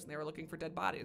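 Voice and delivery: ordinary delivery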